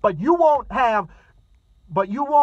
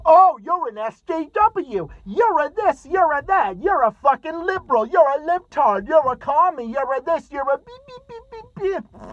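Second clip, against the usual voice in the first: mocking whiny impersonation; 8 semitones higher than usual. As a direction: mimic him like a petulant brat. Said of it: "Rafe Dubin's response would be".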